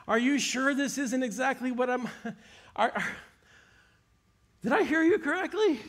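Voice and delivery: can't believe what he just heard, pressing again and again; incredulous father. Retold or imitated.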